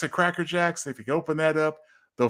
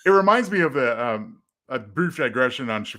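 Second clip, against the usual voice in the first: usual speaking voice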